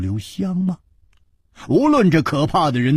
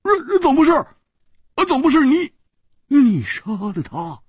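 much higher, stammering disbelief; baffled Hu Tiehua. A narrator's reading. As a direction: demand answers in confusion. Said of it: "胡铁花眨着眼睛说". (胡铁花惊疑追问)

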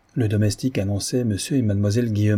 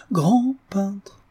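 whispered, higher "grand peintre"; awed whisper; 11 semitones higher than usual. A narrator's reading. Notary murmuring praise to Louis in an awed whisper. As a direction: breathe "grand peintre" in hushed awe.

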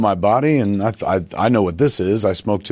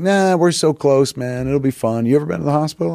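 coaxing medic; 6 semitones higher than usual. Retold or imitated. Medics coax the reluctant patient.

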